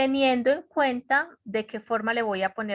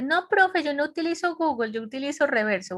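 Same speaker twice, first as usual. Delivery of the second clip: higher, quick student protest; 6 semitones higher, faster than usual. Retold or imitated. Student writing to teacher about translators, high protesting voice. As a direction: imitate a student objecting, pitched up and hurried. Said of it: "me escribió un estudiante".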